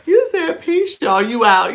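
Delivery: high and loud, bubbly; energetic, laughing delivery; excited read-out. Real, not acted. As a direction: call it out with glee. Reading a chat comment aloud.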